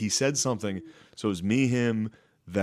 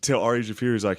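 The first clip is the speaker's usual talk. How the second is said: ordinary talk, nothing marked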